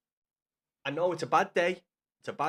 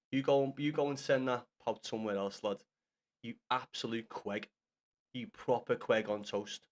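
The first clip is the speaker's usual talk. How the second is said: ordinary talk, nothing marked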